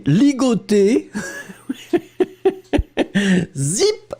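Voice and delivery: slow word dissolving into laughter; amused laughter. Real, not acted. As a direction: crack up at the answer. Teacher laughs at student's verb.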